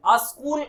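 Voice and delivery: अ स्कूल with a stray "a" first; incorrect pronunciation. Shown as a demonstration. Teacher rejecting the mistake, अ स्कूल as "a-skool". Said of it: "होता ही नहीं है".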